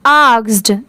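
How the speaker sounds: ordinary talk, nothing marked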